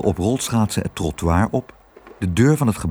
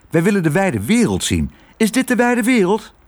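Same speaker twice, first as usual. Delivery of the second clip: high, eager mouse voice; Lodewijk; 10 semitones higher than usual. Acted. Mouse longing to see the world, high eager mouse voice. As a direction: pipe up eagerly, rising higher into the question.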